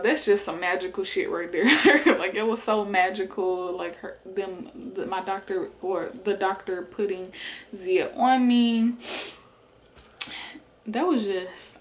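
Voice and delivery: usual speaking voice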